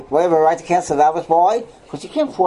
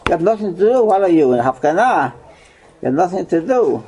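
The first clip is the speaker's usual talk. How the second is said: ordinary talk, nothing marked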